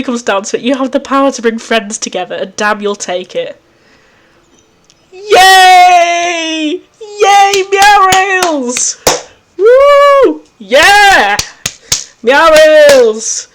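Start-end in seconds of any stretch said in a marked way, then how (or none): 5.1-13.5 high, loud, long-held whoops; jubilant cheering. Real, not acted. Player celebrating Meow Rails, jubilant cheering. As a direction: cheer it out, stretching each word.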